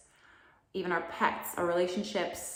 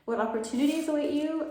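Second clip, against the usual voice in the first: excited; 9 semitones higher, 6 dB louder, faster than usual. Real, not acted. Excited repeat of a tarot hook.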